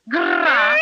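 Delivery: loud rolled growl to scare a spider; a fierce showy bear growl; special growl. Acted. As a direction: growl hard to chase the spider off. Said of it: "one special growl at this spider".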